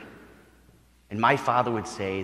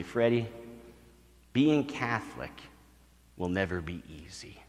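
a quiet, serious uncle to his nephew; solemn advice; 4 semitones lower, 8 dB quieter than usual. Retold or imitated. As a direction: tell the boy gently and gravely.

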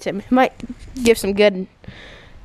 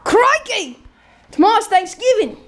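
much higher hammed-up Aussie exclamation; mock Australian accent; 11 semitones higher, 4 dB louder than usual. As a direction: burst out like a fake Aussie TV host. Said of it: "You're not Australian".

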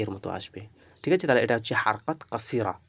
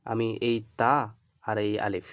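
normal talking voice

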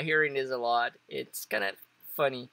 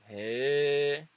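long "Hey" sliding upward; rising intonation. Shown as a demonstration. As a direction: stretch "Hey" into a questioning rise. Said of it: "I like this one better".

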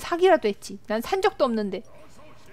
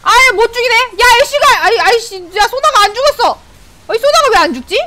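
high, loud outburst; exasperated disbelief; 9 semitones higher, 7 dB louder than usual. Real, not acted. Player whose target will not die, exasperated shouting. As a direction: shout in disbelief that they survived. Enemy survives the attack.

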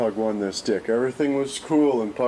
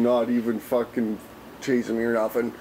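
normal talking voice

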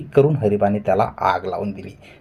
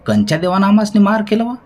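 high, loud, fast retort; flaring up angrily; 12 semitones higher, 6 dB louder, faster than usual. A narrator's reading. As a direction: fire the question back in a temper. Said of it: "Hariba पागुटं मागं सारून शेट्यावर बिथरला".

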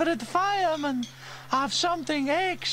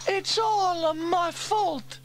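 high, loud, slow lament; drawn-out self-blaming wail; Bella sad; 5 dB louder, much slower than usual. A narrator's reading. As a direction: moan it slowly in a high voice. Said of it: "said Bella sadly".

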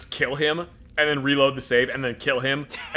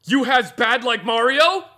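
simple-minded oafish question; dim-witted brute; 10 semitones higher, 5 dB louder, slower than usual. Acted. Dim companion asking Virgil about badness, oafish. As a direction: ask it like a big dumb innocent.

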